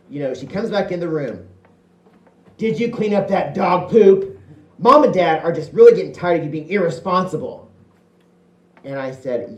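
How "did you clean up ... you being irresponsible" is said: higher, louder nagging big-sister voice; the sister nagging about the dog mess. Retold or imitated. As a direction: scold like a bossy big sister.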